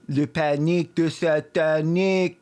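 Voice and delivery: slow, hammed-up fake French; mock French accent. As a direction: say it like a cheesy French phrase. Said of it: "Or as we called it in Canada".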